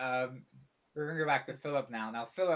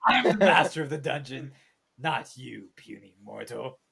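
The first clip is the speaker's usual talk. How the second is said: loud, grand, haughty giant; booming frost giant; 8 dB louder than usual. Acted. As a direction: boom down at a tiny mortal. Dungeon master's grand boast.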